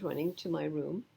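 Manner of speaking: ordinary delivery, nothing marked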